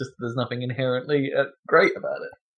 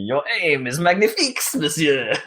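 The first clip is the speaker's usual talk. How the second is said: mock French soldier, pitched up; French accent; 5 semitones higher than usual. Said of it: "Kane will constantly going".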